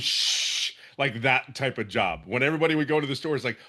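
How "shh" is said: unvoiced shush for silence. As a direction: hush everyone like the boss walked in. Mimics office silence for boss.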